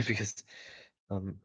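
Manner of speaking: plain reading voice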